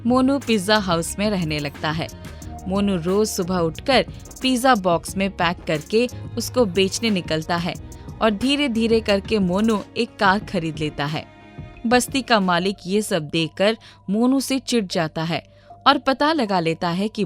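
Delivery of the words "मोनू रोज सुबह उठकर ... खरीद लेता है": usual speaking voice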